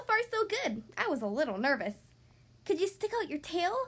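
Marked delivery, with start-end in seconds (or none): none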